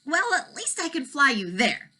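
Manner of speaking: high dragon voice offering a trip; kind dragon. Acted. Dragon offers Elmer a trip, high and eager.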